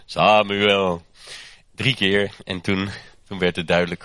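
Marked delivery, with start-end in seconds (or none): 0.1-1.1 louder, distant-sounding call of the name; the voice of God calling the boy. Retold or imitated. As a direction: call Samuel as if from afar. God calling Samuel again.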